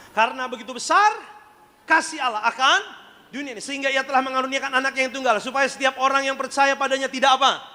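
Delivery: high declaimed scripture recitation; membaca ayat. Real, not acted. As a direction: declaim the verse high, lifting the ends to prompt the congregation. Leads congregation reciting famous verse. (pembacaan ayat lantang berirama)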